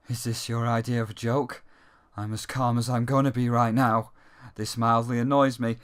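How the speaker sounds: low flat bored line reading; monotone voice. Retold or imitated. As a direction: say it utterly deadpan. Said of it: "monotone voice".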